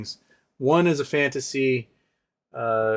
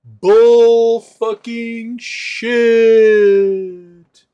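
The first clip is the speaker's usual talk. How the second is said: drawn-out loud, higher yell; outraged shout; 9 semitones higher, 9 dB louder, much slower than usual. Real, not acted. Streamer yelling at a chat question, long and loud. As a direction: stretch each word out in outrage.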